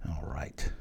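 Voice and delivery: muttering to yourself in a whisper over the board. Real, not acted. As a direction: drop to a private whisper to yourself. Whispered reset while thinking.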